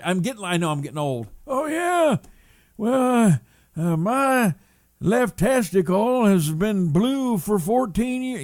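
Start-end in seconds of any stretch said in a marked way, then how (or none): 1.5-8.4 put-on high, slow creaky old-timer; old guy voice. Mocking himself as an old man, high slow old voice. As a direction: ham up a rambling old man. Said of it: "not trying to sound like an old guy".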